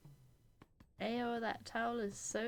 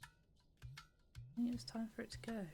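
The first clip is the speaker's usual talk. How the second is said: soft muttered line; 7 dB quieter than usual. Acted. Quiet reading of a game line.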